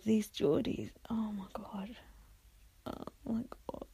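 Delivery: hushed, voiceless dismay; despairing whisper. Pretend. Viewer dismayed by the Geordie housemates, despairing whisper. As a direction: whisper it as if overwhelmed with dread.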